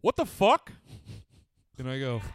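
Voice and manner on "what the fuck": high, loud shout of disbelief; imitated dad yelling; dad's startled yell. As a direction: call out sharply as an alarmed dad. Dad calling out in the dark.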